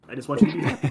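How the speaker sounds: lightly imitated Brussels accent; a hint of Jean-Claude Van Damme's Brussels accent. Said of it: "in his best Brussels accent".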